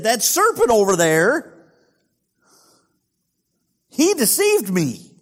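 whiny shift of blame onto the serpent; defensive Eve. Acted.

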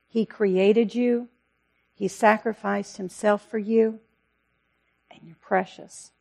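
ordinary talk, nothing marked

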